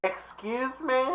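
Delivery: high, soft offended retort; sassy excuse me. Retold or imitated. Answering the rude woman with high, quiet sass. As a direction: raise an eyebrow at her.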